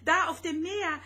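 frightened cry pointing at the sea; desperate cry. A narrator's reading. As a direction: cry out for him in utter need. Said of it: "schrie Rosetta in höchster Not".

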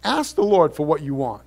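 normal talking voice

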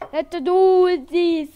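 long, high, very loud yell; drawn-out rallying shout; hyped shout. Pretend. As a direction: belt it out slowly to kick off. Hyping up before singing.

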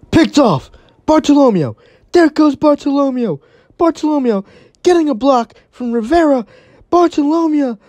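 play-by-play call of an interception touchdown; building excitement. Real, not acted. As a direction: ride the return all the way to the end zone. Pick six run called live.

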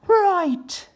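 very high loud right; eager hen agreeing; turkey wife. Acted. Regina answering Solomon in very high loud voice. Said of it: "said Regina".

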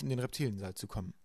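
usual speaking voice

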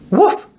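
very high rising woof; eager rising bark; excited bark. A narrator's reading. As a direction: bark with a bright idea. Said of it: "He barks and jumps".

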